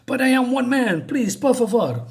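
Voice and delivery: high mock plea; pleading. Scared thug begging the fury, high mock plea. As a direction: beg her in a hammed-up way. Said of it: "this guy is suddenly scared".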